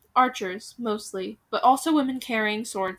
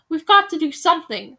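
loud urgent call; 6 semitones higher, 7 dB louder than usual. Acted. Villager calling out to rally others, loud. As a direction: shout it from the crowd. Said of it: "someone else called out".

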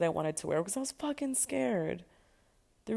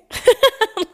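loud shocked laugh; 15 dB louder than usual. Real, not acted. Laughing loud at the coach's bold plan. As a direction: burst of loud, high disbelieving laughter.

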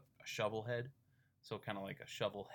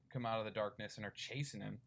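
ordinary delivery

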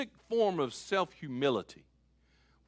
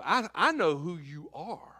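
normal talking voice

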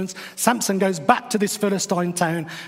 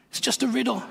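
higher, softer exasperated repeat; 5 semitones higher, 4 dB quieter, faster than usual. Retold or imitated. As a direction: shake your head at how silly it is, softly. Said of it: "I'm thinking".

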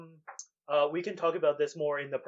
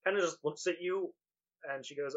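ordinary delivery

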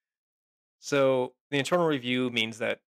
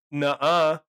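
put-on loud, higher, childish nuh-uh; mocking police denial; 4 semitones higher, 9 dB louder than usual. Mocking cops who brush off citizens, in a loud childish whine. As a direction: whine it like a sulky kid refusing. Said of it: "you're just gonna say".

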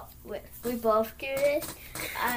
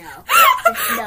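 very high, loud laughing shriek; real laughing squeal; 11 dB louder than usual. Real, not acted.

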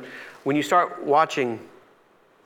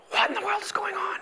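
whisper; 6 dB quieter than usual. Acted. Sailors bewildered in the storm.

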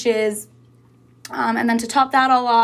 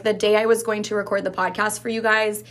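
usual speaking voice

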